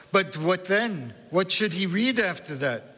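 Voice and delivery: put-on high questioning in a Central European accent; insistent old woman, Central European accent. A narrator's reading. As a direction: press him as the Central European grandmother. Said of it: "in a strong Central European accent".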